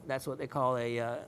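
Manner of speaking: ordinary delivery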